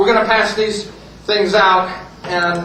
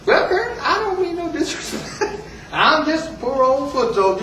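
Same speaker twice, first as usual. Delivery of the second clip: high-pitched feigned humility; high, meek old-soldier voice; mock-humble old soldier; 8 semitones higher than usual. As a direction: plead innocence with a laugh underneath.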